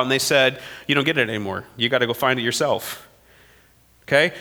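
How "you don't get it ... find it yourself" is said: lower, softer offhand brush-off; curt dismissal. Acted. Overseers lightly voiced brushing off the workers' complaint. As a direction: shrug them off coldly, lightly voiced.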